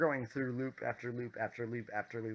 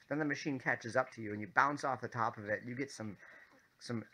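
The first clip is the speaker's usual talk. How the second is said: normal talking voice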